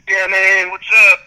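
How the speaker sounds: loud, high, raspy imitation; hammed-up raspy DJ shout; raspy party DJ. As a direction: holler a greeting like a spring break DJ. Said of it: "he had, like, such a raspy voice".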